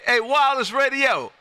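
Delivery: usual speaking voice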